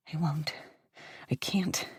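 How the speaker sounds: breathless whisper; barely a whisper, terrified; whispered, trapped and afraid; whispered refusal. Acted. Pinned, barely able to speak.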